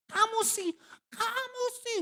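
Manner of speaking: mock quarrel in a high voice; high-pitched bickering. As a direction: whine it up high like a quarreling spouse. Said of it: "Terkadang tuh kita suka nada tinggi ya".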